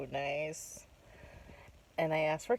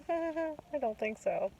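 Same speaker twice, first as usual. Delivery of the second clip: laughing; 11 semitones higher, slower than usual. Real, not acted. Inner reply to compliment.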